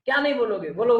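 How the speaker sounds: mock demanding retort; retold challenge. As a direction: fire the question back at the refuser. Devotee goading a refuser, retold.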